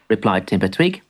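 ordinary delivery

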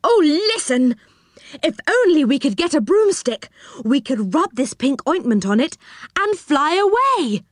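high, eager outburst; very excited. A narrator's reading. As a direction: pour out a thrilling idea. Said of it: "suddenly got very excited".

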